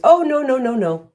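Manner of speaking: high, loud brushing-off no; mock refusal. Someone refusing help, mock dismissive. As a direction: wave off the help, hammed-up. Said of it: "the first thing we may say is".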